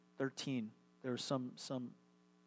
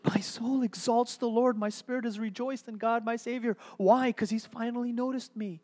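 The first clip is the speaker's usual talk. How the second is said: higher, louder rejoicing, with a rush of giddy joy; excited Mary; 9 semitones higher, 6 dB louder than usual. Retold or imitated. Preacher voicing young Mary's giddy praise, high and excited. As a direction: let Mary's joy bubble up. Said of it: "you can hear her words".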